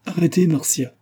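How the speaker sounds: un cri aigu et pressant, qui s'arrête net; s'écrie. A narrator's reading. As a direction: crier pour l'arrêter, le ton montant fort. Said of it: "s'écria le locataire". (il s'écrie pour la faire taire)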